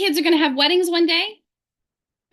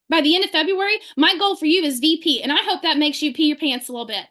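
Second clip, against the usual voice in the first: ordinary talk, nothing marked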